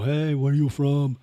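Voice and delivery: mock a massage parlor tout calling; ladyboy calling out. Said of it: "And they go".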